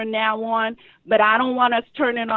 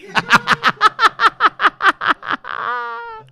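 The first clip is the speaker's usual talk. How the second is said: big high burst of laughter; loud high laugh; 12 semitones higher, 5 dB louder than usual. Real, not acted. Laugh after goodbye.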